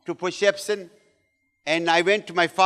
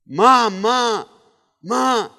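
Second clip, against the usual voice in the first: higher-pitched cry out to a mother; imitated crying plea; 4 semitones higher, 5 dB louder, much slower than usual. Wife calling her husband Ma, imitated tearful cry. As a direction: call for Ma, imitating a tearful woman. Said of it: "And she started crying".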